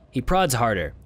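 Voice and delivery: ordinary talk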